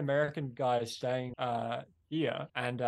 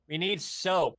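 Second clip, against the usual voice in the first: put-on higher, broad American accent; 5 semitones higher, 3 dB louder, slower than usual. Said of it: "You have a good American impression".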